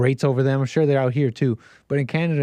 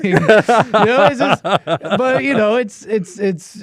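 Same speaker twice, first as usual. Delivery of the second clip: laughing; 5 semitones higher, 6 dB louder, much slower than usual. Real, not acted. Laughing at own joke.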